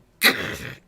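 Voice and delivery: rough, voiceless throat noise of stone crunching; sudden loud guttural crunch; imitated crushing noise. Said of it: "it's clearly trying to sort of go".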